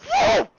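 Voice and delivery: loud, very high "whoo" shooting upward; imitated whoosh. Game master showing the chicken head soaring, loud high whoop. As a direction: mimic something rocketing into the air. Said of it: "The chicken head goes".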